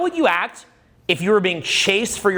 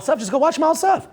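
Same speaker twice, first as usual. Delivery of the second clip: mock high voice; 10 semitones higher than usual. Mocking rebuttal.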